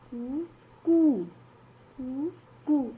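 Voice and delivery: slow drawn-out कू after a short कू; short and long कू. Shown as a demonstration. Teacher to class, slow drawn-out long कू. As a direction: stretch the long कू slowly. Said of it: "slow बोलेंगे और इसको थोड़ा लंबा बोलेंगे".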